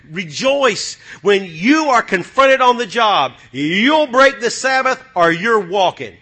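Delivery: loud, slow preaching, hitting each word; full-force sermon shout; fiery preaching. Acted. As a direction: preach it hard to the congregation.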